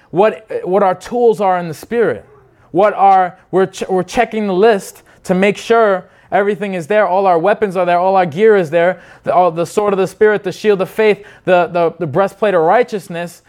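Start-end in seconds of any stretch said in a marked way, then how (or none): none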